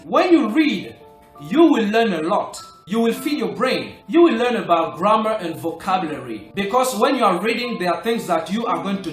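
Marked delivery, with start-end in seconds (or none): none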